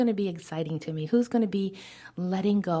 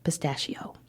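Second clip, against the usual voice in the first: a tiny tearful whisper of one word; barely voiced whisper; 3 semitones lower, 5 dB quieter than usual. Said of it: "then whispered".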